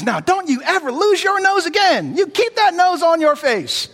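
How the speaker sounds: wag a finger with your voice; scolding nurse. Retold or imitated. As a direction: nurse playfully scolding the lady about her nose. Nurse scolding the old lady.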